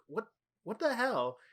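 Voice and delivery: high, drawn-out disbelief; stretched, incredulous question; retold bewilderment. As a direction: act out a baffled "what the hell". Retold bewildered reaction to hang-up.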